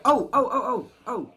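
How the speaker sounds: high, rapid string of ohs; excited outburst. Real, not acted. Streamer reacts to mankini t-shirt question, excited outburst. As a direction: burst in as if bubbling over with news.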